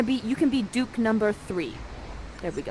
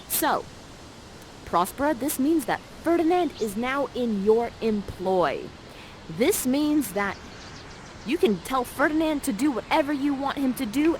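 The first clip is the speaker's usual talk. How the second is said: ordinary talk, nothing marked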